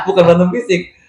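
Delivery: laughing through the words, quick at the end. Real, not acted. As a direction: chuckle it out as you correct the joke. Joking about brain fights.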